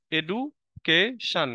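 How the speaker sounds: "Education" slowly split as এডু, কে, শন; syllable by syllable. Shown as a demonstration. Teacher recapping three syllables for students, "education" broken into slow parts. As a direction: pause between the parts of "education". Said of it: "syllable অনুযায়ী আমরা বড় ওয়ার্ডগুলোকে ভেঙে ভেঙে উচ্চারণ করে থাকি".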